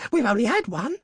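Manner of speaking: high, insistent voice; urgent reply. A narrator's reading. Wife pressing husband about the wishes, urgently. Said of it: "she replied rapidly".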